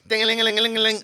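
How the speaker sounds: high, loud mock alarm bell ring; clock ringing imitation. Telling a comedy story, imitating the clock on stage.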